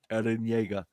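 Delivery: soft, low mock anime "Eren Yeager"; quiet anime response. Recruiter answers a call with a low, lightly voiced anime name. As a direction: mutter the name, then hang up. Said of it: "anime response".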